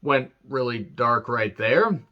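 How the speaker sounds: high, loud, slow awkward "Well"; mock uneasy surprise at a grim turn; drawn-out uncomfortable reaction; taken aback. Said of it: "We're like".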